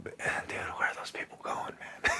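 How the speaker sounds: hushed, breathy whisper to himself; wistful whisper. Retold or imitated. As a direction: whisper it, watching them leave without you. Wistful aside looking at planes.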